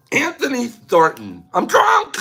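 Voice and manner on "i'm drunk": put-on high squeaky drunk cry; mock drunk squeal. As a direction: squeal it like a tipsy joke. Acting drunk.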